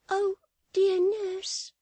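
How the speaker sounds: put-on very high, slow, pleading little girl; child voice. Child appeals to her nurse, pleading child voice. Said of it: "said the child".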